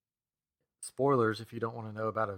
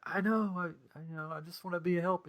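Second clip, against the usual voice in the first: high, stammering, bashful excuse; flustered young hero; 7 semitones higher, 3 dB louder than usual. Retold or imitated. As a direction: act out Peter's sheepish reply. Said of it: "And he's like".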